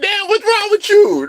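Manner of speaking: high, incredulous outburst; shocked disbelief. Retold or imitated. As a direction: squeal it like you cannot believe it. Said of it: "I'm like".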